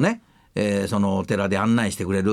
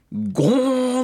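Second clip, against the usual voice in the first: higher, ringing mock bonk sound; imitated bonk; 13 semitones higher, 3 dB louder, much slower than usual. Telling how the chairman hit the glass, a mock bonk. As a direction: make the clunk of a head on glass. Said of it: "ガラスに頭をつけて".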